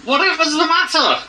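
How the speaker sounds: high, fussing voice of concern; concerned gentleman. Acted. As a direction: fret over the crying pair. Player voices concerned character.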